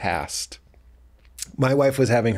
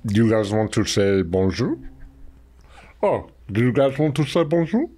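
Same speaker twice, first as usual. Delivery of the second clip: Andre the Giant impression. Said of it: "Andre the Giant impression".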